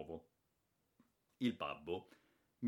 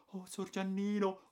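a maid moved to tears; tearful maid; 12 semitones higher, 3 dB louder than usual. A narrator's reading. Maid weeping with joy.

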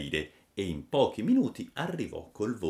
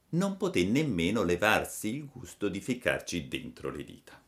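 ordinary delivery, nothing marked

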